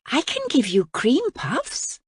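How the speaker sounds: put-on high curious girl's question; young princess. A narrator's reading. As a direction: lift it up like a wide-eyed girl. Voices the princess.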